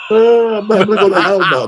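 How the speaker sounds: high-pitched, hammed-up sobbing; mock crying. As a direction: wail it like a guilt-stricken girl, exaggerated. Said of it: "They're crying, like".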